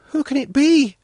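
put-on high, anxious little-girl voice; sinking voice. A narrator's reading. Svetlana hearing gunfire, high voice of mild dread. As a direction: ask as a worried child, pitched high. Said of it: "Svetlana asked in a sinking voice".

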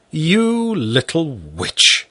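father crying out fondly; cried out. Acted. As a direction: exclaim it slowly, fondly. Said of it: "I cried".

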